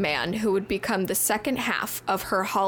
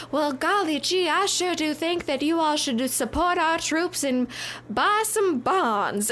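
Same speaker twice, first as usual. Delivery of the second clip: put-on wholesome 1950s kid; 6 semitones higher than usual. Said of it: "America's sweetheart to get up onto the podium and be like".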